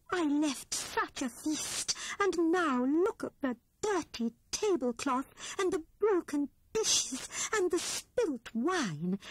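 a gentle housekeeper lamenting her spoilt feast; dismayed Grey Rabbit. A narrator's reading. Grey Rabbit laments the ruined feast to her friends, dismayed.

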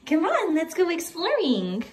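high sing-song talk to a puppy. Real, not acted. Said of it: "Sorry if my puppy voice is annoying".

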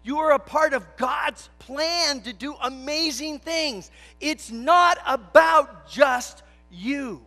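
loud, slow, soaring preacher's proclamation; preaching at full force. Acted. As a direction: proclaim it slowly to the whole congregation. Opening of the sermon.

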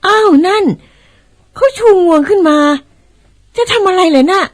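high, alarmed exclamation; startled. Acted. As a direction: gasp out the surprise. Mother startled by elephant moving. (ตกใจ อุทานเสียงสูง)

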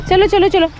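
high, loud urging chant; calling out. Real, not acted. Mother calling toddler back from going too far, high loud chant.

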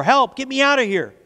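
high storybook cry for help; yelled plea. A narrator's reading. Clay pounds on the oven door, yelling. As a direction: yell to be let out, high-pitched. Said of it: "I yelled and knocked and pounded".

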